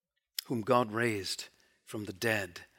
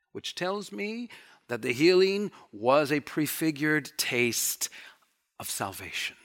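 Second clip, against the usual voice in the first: ordinary delivery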